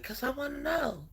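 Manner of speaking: high, soft mock mother voice; imitating mom. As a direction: play the patient mom answering back. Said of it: "And then she'd be like".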